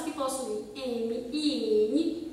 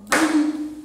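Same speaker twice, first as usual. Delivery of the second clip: "Brinquedo" called out loud, BRIN-QUE-DO; loud syllable split; 10 dB louder, slower than usual. Shown as a demonstration. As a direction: sound out "Brinquedo" loudly for the class. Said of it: "ó".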